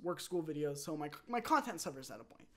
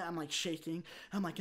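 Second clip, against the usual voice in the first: ordinary delivery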